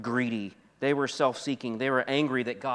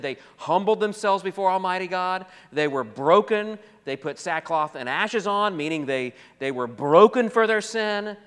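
loud, raised preacher's voice; forceful preaching; 6 semitones higher, 6 dB louder than usual. Acted. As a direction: drive each phrase out, stretching the key words.

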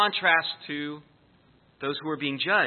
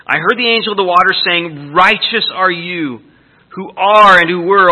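scripture reading; 6 dB louder than usual. Acted. Reads scripture aloud.

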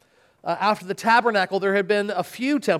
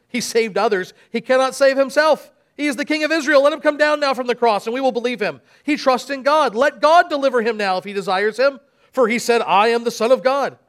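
higher, louder scornful taunt; mocking; 4 semitones higher, 4 dB louder than usual. Chief priests mock Jesus on the cross, scornful taunt. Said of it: "mocked him".

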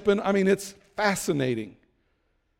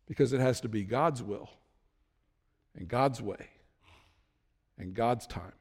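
hushed emphasis; 6 semitones lower, 7 dB quieter than usual. Real, not acted. Preacher drops for emphasis.

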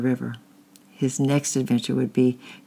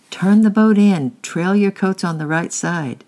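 loud, high bark of orders, slowing at the end; angry yell; 7 semitones higher, 7 dB louder than usual. A narrator's reading. Angry man yelling orders to boys in runaway boat. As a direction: shout the orders across the river. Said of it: "yelled the young, the angry man".

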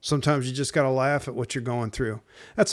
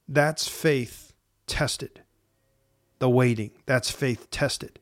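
slow emphasis; much slower than usual. Real, not acted. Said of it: "slow emphatic sermon point".

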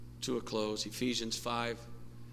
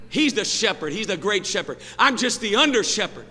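raised, higher preacher's voice; preaching; 9 semitones higher, 10 dB louder than usual. Real, not acted. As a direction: drive each statement home from the pulpit.

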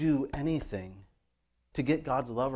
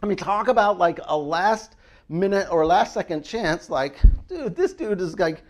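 usual speaking voice

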